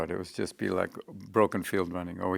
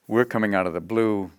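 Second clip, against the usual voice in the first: ordinary talk, nothing marked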